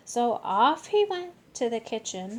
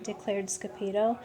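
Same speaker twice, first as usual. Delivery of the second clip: usual speaking voice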